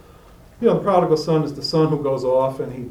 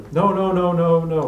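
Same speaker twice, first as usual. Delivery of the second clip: loud, higher insistent no's; firm refusal; 4 semitones higher, 6 dB louder than usual. Retold or imitated. Refusing the host's wine, loud insistent no's. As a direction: push the wine away with each no.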